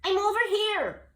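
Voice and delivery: high, loud yell. Acted. Jubal answering the distant voice, yelling. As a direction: yell through the rain. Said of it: "he yelled".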